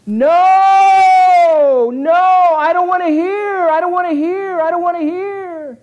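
panicked crowd shouting no; terrified shout. Retold or imitated. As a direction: scream it like a frightened crowd. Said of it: "what do the people do".

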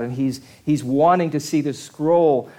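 normal talking voice

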